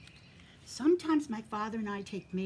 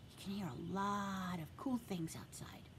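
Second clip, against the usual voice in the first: whisper; 7 dB quieter than usual. Real, not acted. Whispering to the children.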